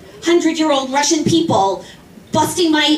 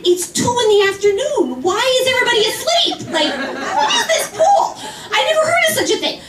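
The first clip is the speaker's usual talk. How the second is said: rising higher and faster into laughing disbelief; incredulous retort; 9 semitones higher, faster than usual. Retold or imitated. To the bratty kids, a laughing, high incredulous outburst. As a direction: laugh it off as absurd.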